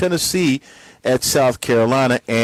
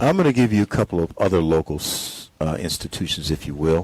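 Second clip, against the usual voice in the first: golf commentator; 7 semitones lower, 4 dB quieter than usual. Acted. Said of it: "Is this your golf announcer's voice?".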